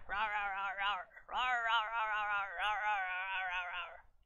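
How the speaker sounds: nonstop silly monster rawrs; playful rawring. Pretend. Artist confessing what a picture does to their brain, playful rawring. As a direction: roar the word over and over in play. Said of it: "my brain is just making me go rawr".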